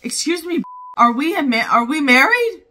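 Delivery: loud, high incredulous challenge; indignant outburst. Real, not acted. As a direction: confront them, outraged and loud. Indignant retort to film character.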